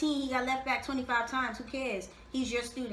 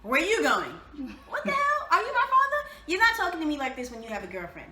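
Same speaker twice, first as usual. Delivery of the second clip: laughing mock outrage, higher and louder; mocking Wes questioning Laurel; mock jealous interrogation; 4 semitones higher, 5 dB louder than usual. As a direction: imitate a possessive guy grilling her.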